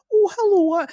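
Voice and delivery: loud, very high put-on voice; squeaky brother voice. Trying out an impression of the boring brother, squeaky. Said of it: "I do his voice for him".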